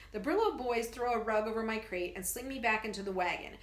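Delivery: ordinary delivery, nothing marked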